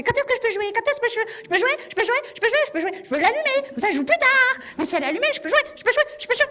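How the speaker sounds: put-on high, insistent kid voice begging; pestering little boy. Little son nagging mum to play games, high and pleading. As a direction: nag to play video games over and over. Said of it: "le petit te regarde, il fait".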